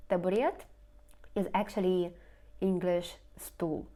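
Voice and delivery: normal talking voice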